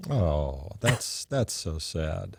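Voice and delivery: soft, slow, drawn-out fake pity; mock sorrow. Co-host feigns pity for the dead villain, soft and slow. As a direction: pretend to be heartbroken, quietly.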